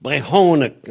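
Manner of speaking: "Brehonek" with breathed h, loud middle syllable; "Brehonek" with aspirated h, punched out; H before vowel. Shown as a demonstration. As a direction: stress the HO in "Brehonek". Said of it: "devant une voyelle, ça devient H".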